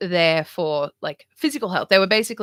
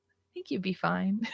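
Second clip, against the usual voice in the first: softly whispered mock comfort; 8 dB quieter than usual. Whispering mock comfort to Donald Trump. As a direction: breathe it as a teasing secret.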